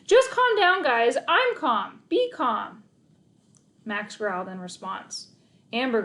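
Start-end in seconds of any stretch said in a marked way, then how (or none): 0.0-2.8 loud, high insistent calm-down; stressed-out dog walker shouting calm; frantic calming. Acted. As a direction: yell for calm. Narrator frantically calming dogs.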